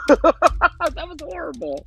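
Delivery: giggling squeal, drawn out; laughing high and slow. Real, not acted. Laughing at own dark joke.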